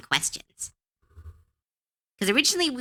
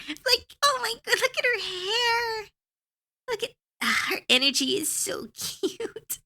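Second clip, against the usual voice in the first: much higher, squealing over her hair; gushing. Real, not acted. Artist squeals over the character's cute hair, gushing. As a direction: gush over the drawing like a fan.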